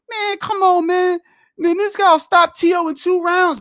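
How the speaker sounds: high, scoffing mimic of a fighter; mocking other fighters. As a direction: sneer it like a fighter brushing T O off. Mocking what all the fighters say.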